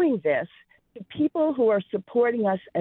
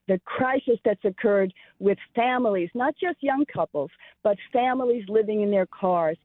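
usual speaking voice

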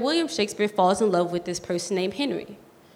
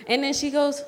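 ordinary talk

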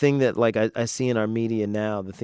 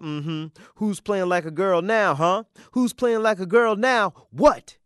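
drawn-out taunt building higher and louder; 10 semitones higher, 6 dB louder, slower than usual. Acted. Kid standing over the bully coach, taunting. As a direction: crow over a beaten bully.

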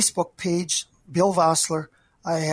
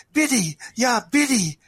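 choked with emotion; words catching in the throat; 6 semitones higher, 4 dB louder than usual. Acted. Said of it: "he choked".

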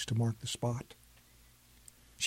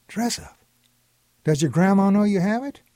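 higher, louder disbelief; can't believe it; incredulous; 6 semitones higher, 4 dB louder than usual. A narrator's reading. Grandpa asking if grandma knows, higher incredulous. Grandpa questions kids, alarmed.

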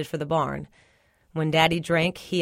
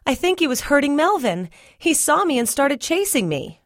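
scared; 8 semitones higher, 3 dB louder than usual. Acted. Scared boy reports to brother.

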